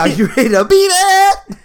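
high, slow mock pop-star voice. Echoing his friend's Beat It joke in a high mock [name] voice. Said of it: "Like a [name] video".

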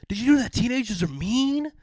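high, loud mock whine; a lightly hammed-up high, loud whine; quoting Tom. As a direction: play the whining Tom. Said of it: "And he said".

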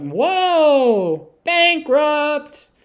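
loud, high game-show yell; excited outcry. Retold or imitated. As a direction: call it out big, like a viewer glued to the wheel. Imitating excited man watching game show.

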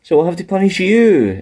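high, rising pitch on the verdict; mock sentencing. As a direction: pronounce the punishment with hammed-up indignation. Acting out bosses punishing a wrestler.

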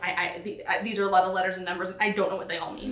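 fast, flustered rambling; mock panic. Student panicking at trig, rattling off fast. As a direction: rush it like a student freaking out.

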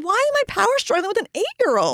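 high-pitched, baffled self-questioning; retold self-exasperation. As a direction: wail it at yourself, half joking.

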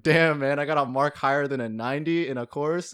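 amazed at your own success; excited disbelief. Retold or imitated. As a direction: relive the thrill, punched out loud. Acted own excited reaction to mark.

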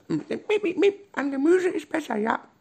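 very high, squeaky dolphin voice; high-pitched cartoon dolphin chirps and speech; squeaking dolphin. A narrator's reading. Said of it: "Der Delfin giekste".

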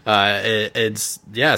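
usual speaking voice

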